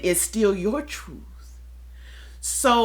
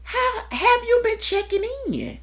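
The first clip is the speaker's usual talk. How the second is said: inner voice questioning; 12 semitones higher than usual. Retold or imitated. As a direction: ask yourself gently but pointedly. Questioning herself in another voice.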